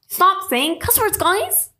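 high, slow, put-on plea; mock scolding. Host pleading with cussing video makers, mock scolding. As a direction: chide them in a silly high voice.

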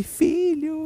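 high, relieved call of a mother. Retold or imitated. Mother finding lost child.

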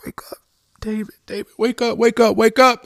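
urgent, high, very loud shouts; escalating to a full-voiced yell; shouting awake. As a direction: shout to wake someone from a nightmare. Wife shouting to wake him.

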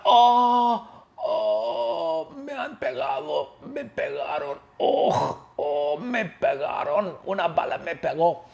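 high, wailing mock pain of a shot cop; over-the-top howl of a wounded officer; hammed-up wounded cry. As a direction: wail like a cartoon cop hit in the rear. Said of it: "Valentín grita".

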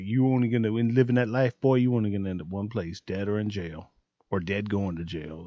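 low, fading mother's warning; a scolding mom, voice sinking low and soft. Retold or imitated. A mom warning her boy about that life, low and fading. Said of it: "someone like [name]'s mom".

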